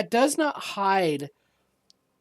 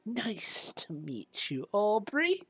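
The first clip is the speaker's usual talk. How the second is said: put-on old shopkeeper; 7 dB quieter than usual. Game master plays old shopkeeper.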